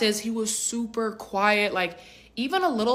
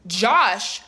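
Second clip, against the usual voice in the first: louder, astonished echo of the name; stunned disbelief; 5 dB louder than usual. Retold or imitated. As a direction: repeat the name as if floored. Stunned repeat of his name.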